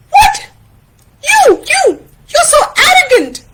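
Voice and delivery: squeaky, spluttering indignation; high-pitched fast outburst; Taín, outraged. Acted. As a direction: stammer an insult in a very high voice. Friends quarrel in story.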